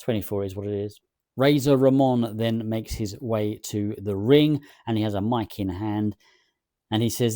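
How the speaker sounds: ordinary talk, nothing marked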